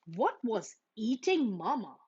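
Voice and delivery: ordinary delivery, nothing marked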